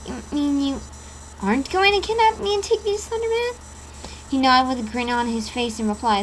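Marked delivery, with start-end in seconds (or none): 0.1-3.5 puzzled question. Acted. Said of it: "asked with a puzzled voice".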